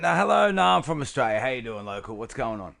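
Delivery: a loud mock Aussie hello; Australian accent. Greeting a local in a loud hammed-up Australian accent. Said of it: "wrong accent".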